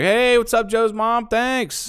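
squeaky over-eager hello; goofy high voice. Pretend. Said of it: "and be like".

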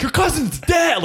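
very high, drawn-out shout; retold furious yell. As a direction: re-enact losing it on an opponent. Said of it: "I'm yelling that at him".

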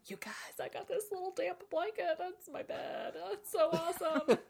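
high, excited whisper; delighted whisper. Retold or imitated. Hess showing off his damp blanket bed, excited whisper. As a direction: whisper it like a thrilled little kid. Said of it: "He's like".

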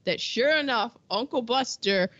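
normal talking voice

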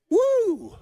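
high, loud joyful whoop; preacher whooping with delight. Real, not acted. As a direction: let out a happy whoop. Preacher excited by his text.

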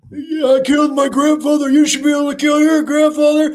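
high, loud cartoon kid voice; squeaky cartoon character. Voicing a character in the episode.

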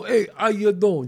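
slow, thick mock Italian-American greeting; lightly hammed Jersey Italian "how you doing"; New York Italian accent. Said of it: "Do you hear me go".

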